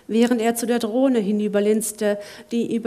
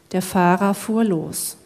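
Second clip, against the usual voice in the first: plain reading voice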